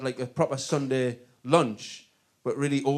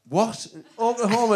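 mock disbelief; 7 semitones higher than usual. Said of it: "he'd go".

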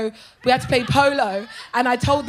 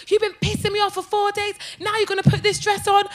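high-pitched indignant sister; angry confrontation; 8 semitones higher than usual. Retold or imitated. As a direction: imitate a sister fed up with you. Acts sister confronting her.

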